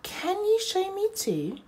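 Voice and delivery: high, small little-creature voice; tiny high voice pleading to join. Acted. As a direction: squeak it like a tiny newcomer. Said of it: "a little voice said".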